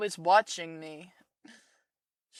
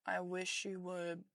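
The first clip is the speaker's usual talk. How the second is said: much softer, distant echo of the line; faint echo; 10 dB quieter than usual. Acted. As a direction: let it fade like an echo. Echoed softer repeat.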